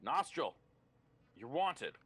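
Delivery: calling out. Acted. Guard summons a prisoner from his cell, calling out. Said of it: "a guard calls out and says".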